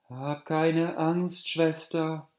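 put-on seven fairies reassuring a scared girl, high and slow; fairies comforting. A narrator's reading. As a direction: soothe the frightened girl slowly. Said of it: "sprachen die sieben Feen".

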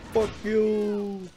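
high, long-held last word; drawn-out taunt. Pretend. Taunting enemies in the game again, long high call. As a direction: sing out YOU high and long.

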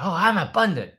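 high, loud imitation of a child; mock kid's exclamation; daughter catching on. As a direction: exclaim it like a little girl who gets it. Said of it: "She goes".

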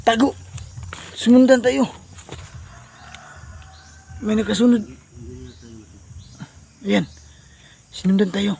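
high, loud alarm; urgent alarm. Real, not acted. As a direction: sound the alarm. Pursuers coming again.

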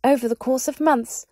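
an anxious underling reporting to his master; high nervous wizard voice; nervous small wizard. Acted.

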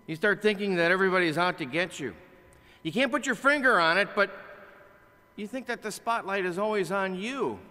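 ordinary delivery

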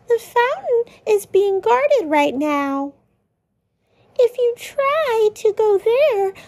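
high nervous Lancer voice; worried Lancer. Acted. Lancer warning friends the fountain is guarded, worried. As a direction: pitch up and stammer with worry.

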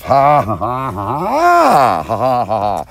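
slow, drawn-out mock villain laugh; hammed-up slow gloating laugh. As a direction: laugh like the Count savoring revenge. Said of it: "That's why he gets to laugh".

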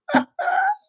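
sudden high burst of laughter. Real, not acted. Laughing at her own jab at the rival, high cackle.

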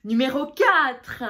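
loud, drawn-out ranking call; countdown announcement. Real, not acted. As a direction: announce the next rank with a big, stretched ending. Countdown of top five accents.

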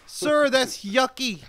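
hammed-up, high-pitched disgusted child; mock ten-year-old yelling. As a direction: squeal loudly in a very high kid voice.

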